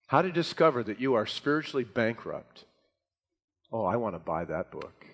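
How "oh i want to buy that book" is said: low, quiet deadpan irony; dry sarcasm. Acted. Preacher mocks a book nobody would buy, dry sarcasm. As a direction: feign interest, low and under the breath. Mocking an unappealing book title.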